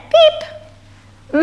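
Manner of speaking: tiny, very high imitated cheep; chick's peep. A narrator's reading. Chick answering in a children's story, high peep. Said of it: "and her chick said".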